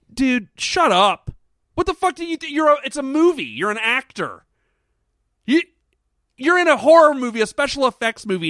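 high-pitched, exasperated telling-off; mock-scold an actor in disbelief; worked-up "dude, come on" rant. Ranting imagined reply to actor.

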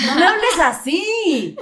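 playing the hurt listener, hammed up; mock offended protest. Friend mocking a northern-accent complaint, loud mock offense. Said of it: "es como que".

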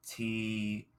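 normal talking voice

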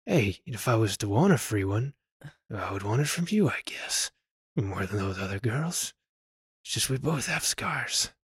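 low, soft, near-whispered tough guy; Clint Eastwood. Retold or imitated. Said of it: "It's the impressions going a little".

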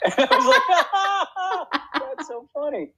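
high, breathless laugh through the words; cracking up while telling it. Real, not acted. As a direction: giggle it out helplessly.

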